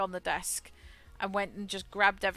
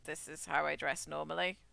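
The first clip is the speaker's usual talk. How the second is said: ordinary talk, nothing marked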